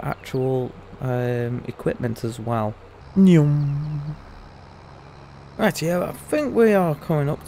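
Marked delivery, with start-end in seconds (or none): 3.1-4.1 a loud, hammed-up "nyum" munch; mock munching noise. Gamer to his viewers, a loud silly munch. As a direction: smack out a silly eating sound. Gameplay vlog catchphrase noise.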